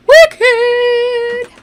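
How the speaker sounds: squealed high and loud. Real, not acted. Streamer thanks chat for the tip, squealing high. Celebrating game update.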